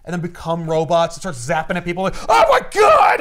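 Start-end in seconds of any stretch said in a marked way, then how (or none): 2.3-3.2 high, loud hammed-up alarm; mock panicked shout. Soldiers getting zapped by robots, shouted mock panic. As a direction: shout out in comic terror.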